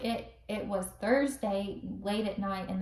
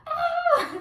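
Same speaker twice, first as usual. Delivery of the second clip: high, loud "ah" of pain; sudden high-pitched yelp; re-enacted scream; 8 dB louder than usual. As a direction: imitate a labor scream. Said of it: "And I, like, screamed".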